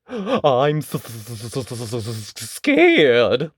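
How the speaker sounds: stammered frightened wail, voice swelling on scared; lion stutters in fear; scared lion stammering out scared; stuttering wail. Acted. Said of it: "he wailed".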